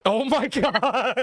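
slow, drawn out through laughter; cracking up at the broken trunk; laughing. Real, not acted. Friends on a call, laughing hard at the trunk.

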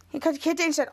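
ordinary delivery

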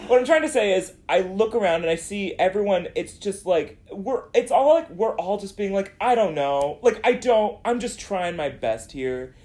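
high, flustered, laughing; hurried laughing explanation. Real, not acted.

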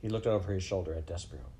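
ordinary delivery

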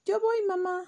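high, loud chick voice; eager little chick. Acted. Chick eagerly answering mom, high and loud.

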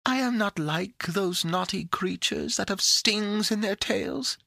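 high, coaxing little creature voice; sweet, cheerful witchery. A narrator's reading. The creature in the box begs Pandora to free it, sweetly coaxing. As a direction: wheedle sweetly in a tiny high voice. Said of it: "there was a kind of cheerful witchery in the tone".

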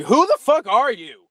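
loud, high mock disbelief; incredulous retort. Friends baffled by the cop's gangster talk, incredulous. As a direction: throw it out in outraged bafflement.

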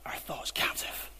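whispered. Acted. Preacher quoting Bible phrase.

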